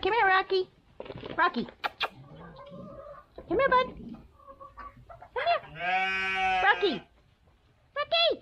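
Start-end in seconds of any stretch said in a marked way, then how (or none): none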